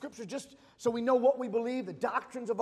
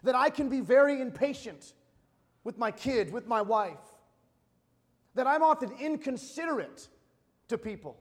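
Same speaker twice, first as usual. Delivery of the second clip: full force preaching; 3 semitones higher, 5 dB louder than usual. Acted.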